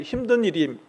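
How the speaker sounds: ordinary delivery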